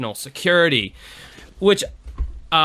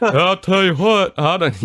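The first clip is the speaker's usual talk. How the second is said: much higher, louder cartoon Texan; Hank Hill impression; 6 semitones higher, 4 dB louder than usual. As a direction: ham up a Texan dad voice. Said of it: "Hank Hill".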